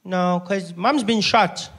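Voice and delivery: put-on little boy, slow and matter-of-fact; nine-year-old stretching the words calmly; Isaac. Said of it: "He's nine years old".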